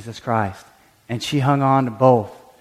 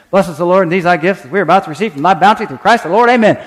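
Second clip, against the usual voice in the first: "Bless us, the Lord" gabbled fast and louder; rushed rote prayer; 4 semitones higher, 5 dB louder, much faster than usual. Shown as a demonstration. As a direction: race through the meal prayer. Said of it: "how fast we can pray that prayer".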